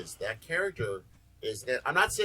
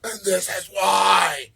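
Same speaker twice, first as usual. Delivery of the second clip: slow, much louder, higher shout; outraged shout; 8 semitones higher, 18 dB louder, much slower than usual. Retold or imitated. Scholar correcting his kids, outraged slow shout. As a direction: bellow it like a wounded fan. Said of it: "looks at him and goes".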